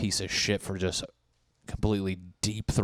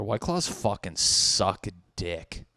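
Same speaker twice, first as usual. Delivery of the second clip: ordinary talk, nothing marked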